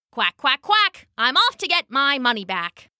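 high quacking duck voice, quack jumps up high; perky duck chant; plucky duck. Acted. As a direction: chirp it out like a brave little duck.

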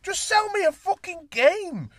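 high, loud, slow furious ranting; angry rant. Real, not acted. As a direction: spit out each word in fury. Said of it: "I'm very angry, can you tell?".

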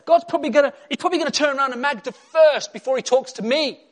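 high, loud impassioned preaching; shouted, fired-up sermon climax; full-force preaching. Real, not acted. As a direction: drive it home to the congregation.

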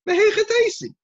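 very high, loud outburst; exclaimed challenge. Acted. Scholar challenging an unsupported claim, exclaiming. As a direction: cry it out in disbelief.

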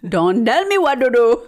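bouncy put-on Indian accent; exaggerated mock Indian accent with Ds for Ts. Shown as a demonstration. Showing off the skit line to a friend, imitating an Indian accent. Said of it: "speaking an Indian accent".

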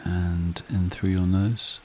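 slow, calm meditation guide voice; guided meditation. Acted. Meditation host guiding listeners to relax, calm and unhurried. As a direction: ease the listener down into stillness.

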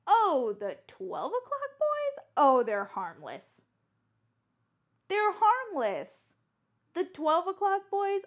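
high, loud, slow amused coworker voice; breezy high amused dismissal; coworkers' voice. Retold or imitated. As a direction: play the breezy local who's seen it all. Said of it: "and they were like".

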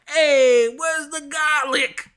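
a cartoon Italian asking for garlic; mock Italian. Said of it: "didn't come up and be like".

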